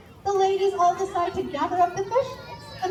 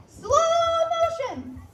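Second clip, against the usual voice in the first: battle cry; 9 semitones higher, much slower than usual. A narrator's reading. Calling a slow motion charge.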